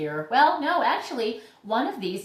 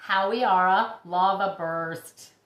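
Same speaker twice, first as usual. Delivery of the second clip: usual speaking voice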